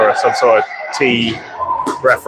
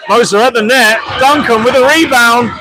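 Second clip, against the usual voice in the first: higher, louder rising shout at the net; excited call; 11 semitones higher, 4 dB louder than usual. Acted. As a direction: lift your voice as the chance builds. Goalmouth scramble called live.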